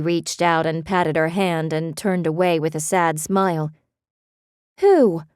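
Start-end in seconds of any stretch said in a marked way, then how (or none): 4.8-5.2 sharp, high, loud demand. A narrator's reading. Beth demanding Benny name who blocked her, sharp demand. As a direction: insist on an answer. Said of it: "she demanded".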